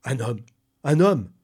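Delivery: building from normal to a high loud shout; rising ape-man excitement; excited cry. Acted. The ape man shows off the living man, building to a shout.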